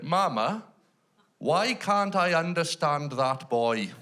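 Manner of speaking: put-on high, slow child voice; drawn-out childlike question; little girl. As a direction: imitate a toddler puzzling aloud to her mum. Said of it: "then the little girl".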